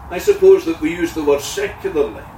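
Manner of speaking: usual speaking voice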